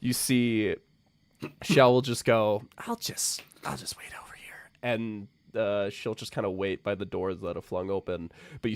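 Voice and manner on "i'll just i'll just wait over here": whispered, sneaking away; embarrassed aside to no one; awkward whisper. Acted. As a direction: whisper it and back off quickly. Shell awkwardly backs away.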